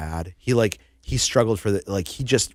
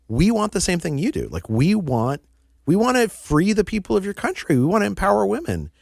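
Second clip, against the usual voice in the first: hoger, vurig pleidooi van Jim; earnest eager pitch; 10 semitones higher than usual. Retold or imitated. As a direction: pitch it high and eager, like an idealistic recruiter.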